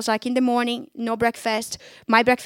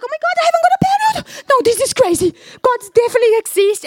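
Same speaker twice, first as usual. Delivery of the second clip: much higher, louder and fast, astonished; amazed excitement; 12 semitones higher, 6 dB louder, faster than usual. Retold or imitated. Waking up healed and realising it, amazed excitement. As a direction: gasp it out, thrilled and disbelieving.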